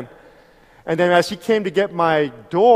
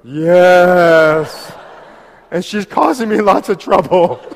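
driver laughing through the answer; laughing admission; 3 semitones higher, 7 dB louder, slower than usual. Retold or imitated. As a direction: chuckle the confession out loud. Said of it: "And we just started laughing".

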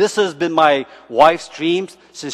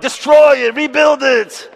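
higher and louder, laughing; mock demand; 9 semitones higher, 5 dB louder than usual. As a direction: demand it be torn down. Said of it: "We're like".

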